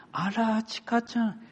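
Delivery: high-pitched grandparent cooing over a grandchild; doting grandparent. Retold or imitated. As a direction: imitate a grandparent admiring a little one. Grandparent greeting grandchild on video call.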